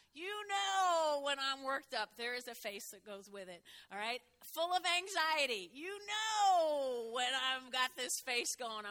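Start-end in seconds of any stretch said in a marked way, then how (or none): 0.1-2.2 worked up. Shown as a demonstration. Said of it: "the other one is worked up". 4.4-8.9 loud, slow, strained show of anxiety; mock anxious outburst. Shown as a demonstration. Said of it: "I'm full of anxiety".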